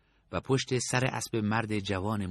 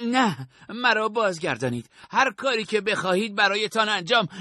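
much higher, desperate plea; pleading; 10 semitones higher, 4 dB louder than usual. A narrator's reading. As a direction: beg them not to send him back. Sahab begs not to return.

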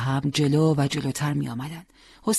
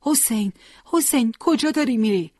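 calling after a friend, a touch raised; 6 semitones higher than usual. A narrator's reading. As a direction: call his name as he crawls away. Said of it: "او را صدا زد". (صدا زدن با صدای کمی بلندتر)

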